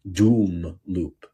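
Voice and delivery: DOOM drawn out low; a long, low-key DOOM before loop; emphasis on DOOM. Real, not acted. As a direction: lean on the word doom, stretching it. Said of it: "emphasis on the word doom".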